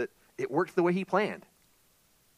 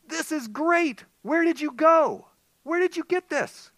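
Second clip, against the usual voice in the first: much higher, loud excited questions; 10 dB louder, slower than usual. Acted. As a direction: burst with delight. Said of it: "Naomi must have been pretty excited".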